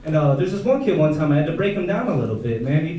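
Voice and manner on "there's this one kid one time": usual speaking voice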